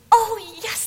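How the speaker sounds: put-on high, eager kids answering yes; eager children.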